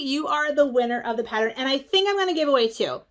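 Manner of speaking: usual speaking voice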